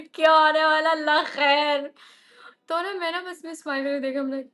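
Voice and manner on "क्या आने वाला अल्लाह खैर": mock nervous dread. Nervous aside before his confession.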